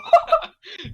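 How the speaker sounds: high shrieking villain cackle; loud unhinged cackle; evil laugh. Pretend. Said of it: "Le concours de rire maléfique". (rire maléfique aigu)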